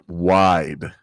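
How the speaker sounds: ordinary talk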